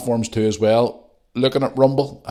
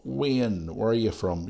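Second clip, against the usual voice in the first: ordinary delivery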